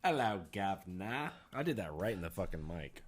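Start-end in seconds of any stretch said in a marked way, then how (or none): 0.0-1.3 put-on slow, drawn-out English accent greeting; playful English accent, stretched out; mock English accent. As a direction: put on a cartoon English accent, without shouting. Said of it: "I sounded just like the dude".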